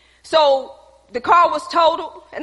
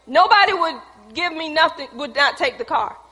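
normal talking voice

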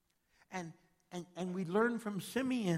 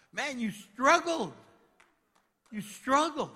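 loud, high, slow cry of sympathy; preaching burst; 10 semitones higher, 12 dB louder, slower than usual. Acted. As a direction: call it out to the congregation.

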